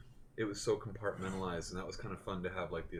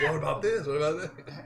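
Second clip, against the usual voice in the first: eager questions; 9 semitones higher, 9 dB louder than usual. Retold or imitated. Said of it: "me being like".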